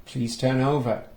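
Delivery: ordinary delivery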